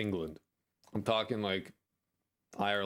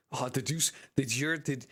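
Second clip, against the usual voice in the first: usual speaking voice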